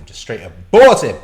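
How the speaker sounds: loud shocked outburst; incredulous shout. Real, not acted. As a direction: exclaim it in outrage. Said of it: "repeated in disbelief, shouted".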